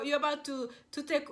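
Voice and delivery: ordinary talk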